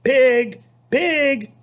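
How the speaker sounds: high, loud kind pig call; loud coaxing farmer's call. Acted. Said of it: "in a kind voice".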